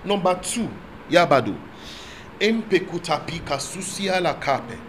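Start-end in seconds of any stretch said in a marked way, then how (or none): 1.1-4.8 soft steady prayer in tongues; speaking in tongues. Real, not acted. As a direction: pray quietly in the spirit. Praying in tongues.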